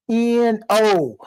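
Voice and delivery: N O spelled letter by letter. Shown as a demonstration. Preacher spelling the word for the audience, letter by letter. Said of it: "spelling no out".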